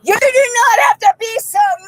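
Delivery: real high-pitched yell; indignant shouting. Real, not acted. Detainee protesting to officer forcing her in, shouting. Detainee argues during arrest.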